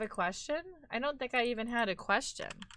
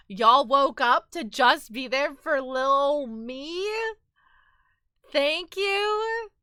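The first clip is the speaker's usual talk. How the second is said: gushing; 5 semitones higher, 9 dB louder, slower than usual. Real, not acted. Gushing thanks to viewers.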